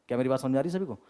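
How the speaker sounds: normal talking voice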